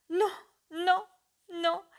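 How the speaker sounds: high, loud, anguished "non"; desperate refusal. Acted. Weeping mother refuses to uncover her face, desperate. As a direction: push him away in panic.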